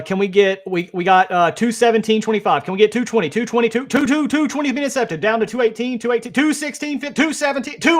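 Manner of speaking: rapid, high auctioneer chant. Pretend. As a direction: rattle off numbers like an auction caller. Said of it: "just like an auctioneer".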